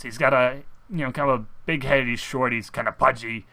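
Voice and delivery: normal talking voice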